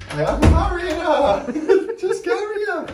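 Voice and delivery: high, drawn-out words through laughter; laughing protest. Real, not acted. Dad answering "don't carry her", laughing and high. Laughing while lifting the dog.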